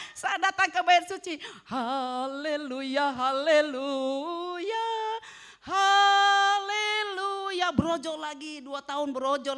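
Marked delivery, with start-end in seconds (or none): 1.7-7.7 very slow, loud drawn-out haleluya; ecstatic praise. Retold or imitated. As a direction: stretch each haleluya in mock rapture. (haleluya dipanjangkan keras dan sangat pelan)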